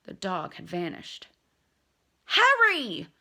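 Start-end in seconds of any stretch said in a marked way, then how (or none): 2.2-3.1 loud, high, desperate shout across the pitch; anguished yell. Acted. Captain yelling a warning to Harry, anguished shout. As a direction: yell his name in anguish. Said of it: "came Wood's anguished yell".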